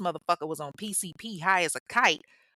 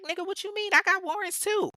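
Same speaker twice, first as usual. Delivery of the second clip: much higher, mock-indignant comeback; imitating indignant Michelle; 14 semitones higher than usual. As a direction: squeak it up high like an offended retort. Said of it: "And Michelle is like".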